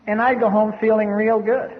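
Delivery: ordinary talk, nothing marked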